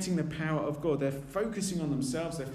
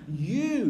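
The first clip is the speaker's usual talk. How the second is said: "You" hit louder as an emphatic command; 4 semitones higher, 5 dB louder than usual. Retold or imitated. Jesus commanding the disciples, stress on "You". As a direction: punch the word "You" as a command. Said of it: "that's quite emphatic in the Greek".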